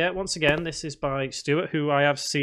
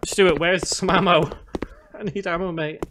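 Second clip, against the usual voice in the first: desperate call, louder and higher; frantic plea mid-fight; panicking gamer; 3 semitones higher, 4 dB louder than usual. Real, not acted. Begging for ammo in game.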